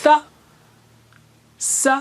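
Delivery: ث and س said alone with pauses; teacher contrasting the s-sounds; letter contrast. Shown as a demonstration. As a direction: set ث against س. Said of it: "یہ تین".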